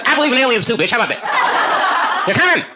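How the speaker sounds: high, loud alien-believer shout; mock conspiracy yell.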